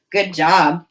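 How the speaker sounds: usual speaking voice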